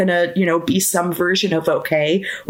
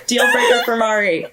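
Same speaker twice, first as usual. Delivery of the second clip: laughing shout; 3 semitones higher than usual. Real, not acted. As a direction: call it out through laughter.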